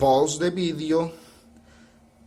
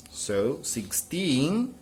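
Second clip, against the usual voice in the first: "sixteen" very slow, rising at the end; "sixteen" drawn out with a rising end; stress at the end; much slower than usual. Shown as a demonstration. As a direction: stretch "sixteen" and lift "teen". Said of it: "Let's repeat it again".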